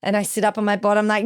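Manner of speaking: ordinary talk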